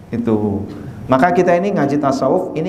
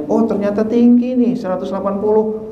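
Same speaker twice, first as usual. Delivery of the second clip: 1 surprised doctor; 7 semitones higher than usual. Retold or imitated. Doctor reads blood pressure.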